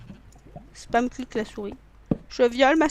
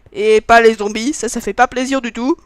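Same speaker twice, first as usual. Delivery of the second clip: loud, slowed shout at the zombies; 11 dB louder than usual. Real, not acted. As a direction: yell it at the zombies coming.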